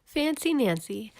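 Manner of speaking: ordinary delivery, nothing marked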